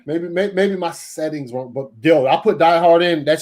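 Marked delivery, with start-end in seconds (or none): none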